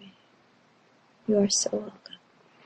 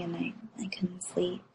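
whispering; 16 dB quieter than usual. Real, not acted. Late at night, partner asleep.

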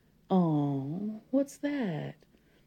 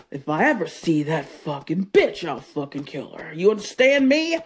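loud, furious claiming of him; possessive rage; 8 dB louder than usual. Acted. Jealous wife vowing nobody takes her husband, shouting. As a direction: shout that he is hers alone.

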